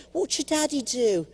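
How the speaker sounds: lightly voiced little British kid. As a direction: ask like a curious kid. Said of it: "I heard the kid say".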